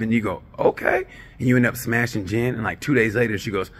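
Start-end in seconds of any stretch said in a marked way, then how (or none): 0.6-1.0 high squeaked "okay"; a startled little squeak; mock surprised okay; squeaky okay. Guy reacts to being left with Jen.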